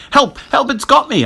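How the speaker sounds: very high cry for help; frantic, high-pitched terror; panicked shriek. A narrator's reading. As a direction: shriek as something grabs you. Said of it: "I shrieked".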